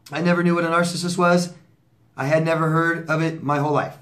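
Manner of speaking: host reading a viewer's letter aloud. Acted. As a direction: read the message out for the audience. Reads another viewer letter.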